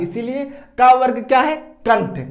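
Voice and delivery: normal talking voice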